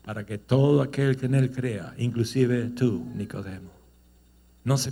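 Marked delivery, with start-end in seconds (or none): none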